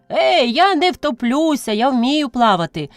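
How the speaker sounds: put-on high-pitched boyish boasting; bravado. A narrator's reading. Boy assures grandmother he can swim, full of bravado. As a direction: brag like a fearless little boy. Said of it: "храбрував Миколка".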